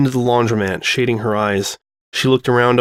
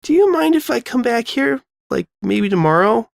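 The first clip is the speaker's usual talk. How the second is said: put-on much higher, shy girlish ask; bashful high voice; 11 semitones higher than usual. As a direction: ask hopefully, a little embarrassed. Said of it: "the blush creeping back into her cheeks".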